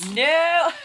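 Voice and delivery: loud high burst of laughter; shocked laughter. Real, not acted. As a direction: crack up in disbelief. Reacting to book sign.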